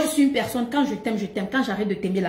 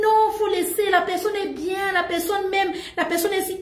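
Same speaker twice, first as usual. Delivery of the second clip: retold defence; 7 semitones higher than usual.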